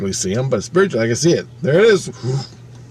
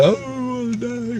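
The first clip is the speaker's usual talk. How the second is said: high, crying voice breaking into a wail; a high sobbing whine of despair; mock crying wail; 13 semitones higher, 3 dB quieter than usual. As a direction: wail it like you are sobbing. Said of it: "And I'm crying".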